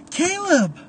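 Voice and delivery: high, loud delighted call; excited cry. Acted. Tara spotting Caleb return, calling out happily. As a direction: call his name, thrilled to see him.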